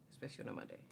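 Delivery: breathy whispered repeat. Real, not acted. Whispering the Monday tip to her viewers.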